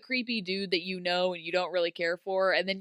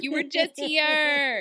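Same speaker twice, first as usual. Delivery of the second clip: high, loud, drawn-out mockery; exasperated mock correction, pitched up; mocking retort; 6 semitones higher, 5 dB louder, slower than usual. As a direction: stretch it out as a teasing comeback.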